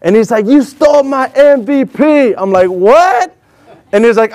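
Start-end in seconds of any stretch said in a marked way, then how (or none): 0.5-2.3 high, slow, acted confrontation; mock-angry imitation; accusing. 2.7-3.4 high-pitched acted disbelief; retold shocked question; startled. His startled reply to accusation.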